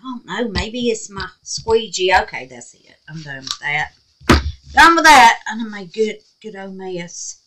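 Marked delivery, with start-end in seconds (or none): 4.7-5.4 loud, high, falling declaration; real exasperated burst, pitched up; emphatic. Real, not acted. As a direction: call it out louder to be finished with it. Repeats it emphatically, giving up.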